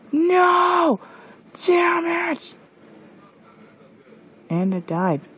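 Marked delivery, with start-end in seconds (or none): 0.1-2.5 high angry yell; furious yell; frustrated shout. Real, not acted. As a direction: curse the loss. Zombie villager dies.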